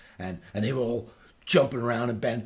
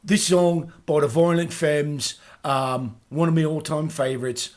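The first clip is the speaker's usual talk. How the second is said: louder upbeat DJ intro; radio host hyping a song; 7 semitones higher, 9 dB louder than usual. Acted. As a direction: address the listening audience like a presenter.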